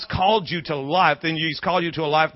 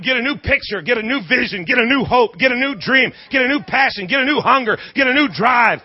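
preaching at full force; 5 semitones higher than usual. Real, not acted. Pastor rallying the congregation, full preaching voice. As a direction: build each line like a crescendo.

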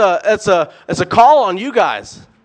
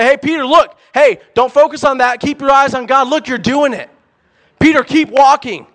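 high, loud shouts of encouragement from the boat; excited, rising cheers calling Peter to keep going; excited cheering; 6 semitones higher, 3 dB louder than usual. Retold or imitated. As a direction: cheer him on like a crowd. Imagined disciples cheering Peter on.

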